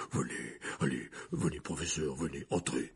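very low, soft welcoming rumble; Hagrid. A narrator's reading. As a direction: usher someone in, voice dropped deep. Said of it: "répondit Hagrid". (voix très grave et accueillante)

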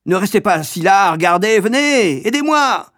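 ordre aigu et plus fort, qui monte; appel criant. A narrator's reading. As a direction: presse les enfants d'aider, en criant. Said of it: "cria la grande sœur". (la grande sœur crie aux enfants, suppliante)

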